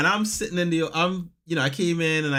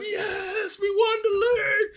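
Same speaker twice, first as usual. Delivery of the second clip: much higher, crying out in joy; ecstatic cry; slower than usual. Retold or imitated. Two fans spinning each other round in celebration. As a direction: scream the victory.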